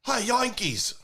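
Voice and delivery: high, hammed-up British taunt; British accent. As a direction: do a shaky-aim British jeer. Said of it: "What accent's that? That's like".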